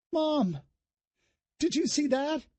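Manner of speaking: put-on high girl's voice, breathless triumph; a girl thrilled at her own shot; excited young girl. A narrator's reading. Daughter showing off to her mother after the shot, high and excited.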